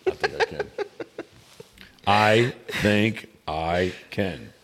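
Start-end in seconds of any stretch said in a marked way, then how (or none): 2.1-4.5 drawn out word by word; slow and deliberate. Pretend. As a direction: stretch every word with a gap between. Repeats it very slowly, word by word.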